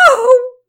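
loud, high "Oh!" of sudden pain; batter's sharp high yelp; pained cry out. A narrator's reading. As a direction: cry out as if just hit by a ball. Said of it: "the batter cried out".

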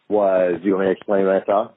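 normal talking voice

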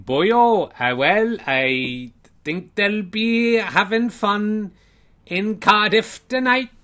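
slow, loud, high mock-Welsh singsong; terrible Welsh accent. Welsh player boasting to interviewer, terrible Welsh accent. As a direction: stretch it out in a badly faked Welsh lilt. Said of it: "the terrible accent you're doing".

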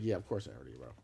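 low, soft grudging admission; mild mock of a guy caving in; mumbled admission. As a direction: admit it under your breath. Gear Wrench guy admits knowing them.

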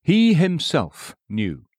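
"He himself knew" said slowly, each word weighed, falling at the end; slow emphatic phrase. Shown as a demonstration. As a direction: dwell on "He", slow and deliberate, then fall. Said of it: "pull them to pieces for a minute".

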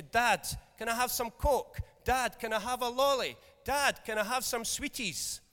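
put-on high, pleading child voice; begging child. Child begging dad for sweets, high and pleading. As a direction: kid wheedling dad for sweets.

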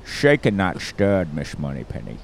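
suave film-spy impression, falling in pitch; slurred s's in a Scottish accent; Sean Connery. Ordering a drink from Miss Moneypenny, mock Scottish spy. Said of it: "Sean Connery is Scottish".

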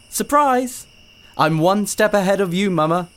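put-on high, bright boy's voice; springing a surprise, gleeful; excited child. Boy springs surprise on mother.

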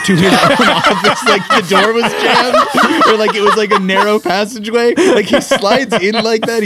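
laughing while describing the squeeze; cracking up at the absurd scene. Real, not acted.

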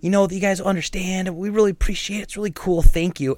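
mock-sweet shopkeeper thanking a masked customer; sugary politeness. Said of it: "I'm not even going like".

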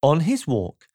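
ordinary delivery

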